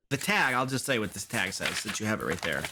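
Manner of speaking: normal talking voice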